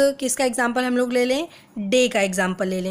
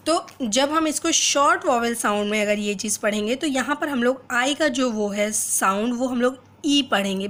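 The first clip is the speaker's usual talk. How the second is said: usual speaking voice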